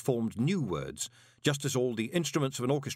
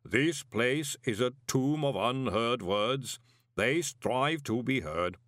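very slow, weighty clay giant; solemn and heavy; lightly voiced rumbling golem; ponderous golem; 2 semitones higher, slower than usual. A narrator's reading. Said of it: "rumbled the golem ponderously".

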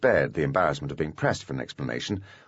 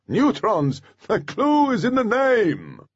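high, slow, snapping professor; angry shout; 5 dB louder, slower than usual. A narrator's reading. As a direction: shout it at a stupid pupil. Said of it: "Professor Leguminous had snapped".

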